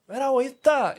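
high, eager quoted voice; quoting the fan. Retold or imitated. Fan announcing he is coming over, eager high voice. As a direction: play the fan who shows up at the door.

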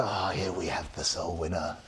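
low, hushed nature documentary narrator; David Attenborough voice. Retold or imitated. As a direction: softly narrate like a wildlife film. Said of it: "David Attenborough might say".

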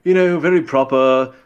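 a touch of a proper upper-class Brit; Queen's English. Shown as a demonstration. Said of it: "Queen's English".

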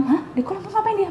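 high, startled "Hah?" then the question; mock disbelief at a child punished in a pond; shocked disbelief. Recalling a teacher's story, she replays her own shocked reply.

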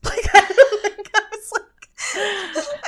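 talking through laughter; laughing. Real, not acted. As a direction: giggle while trying to talk. Laughing at own reaction.

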